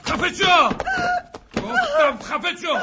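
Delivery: very loud, high, slow roar; furious shout. Acted. Gunman screaming at the begging boss, furious roar. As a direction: scream at him to shut up.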